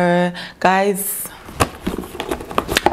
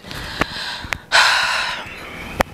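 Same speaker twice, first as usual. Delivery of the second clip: emotional sigh; 4 dB quieter than usual. Real, not acted. Moved by a kind gift.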